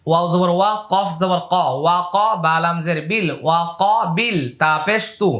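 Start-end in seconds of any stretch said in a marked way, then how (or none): none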